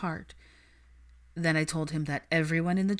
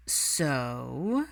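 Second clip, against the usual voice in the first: So stretched long and low; dragged-out So; 4 semitones lower than usual. Acted. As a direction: draw So out dramatically. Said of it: "George dragged the word out dramatically".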